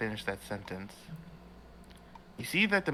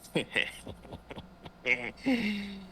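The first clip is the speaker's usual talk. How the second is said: ominous villain chuckle; 9 semitones higher than usual. Acted. Villain heard from behind the players, gloating snicker. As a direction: laugh like a villain revealing himself. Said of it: "And you hear this".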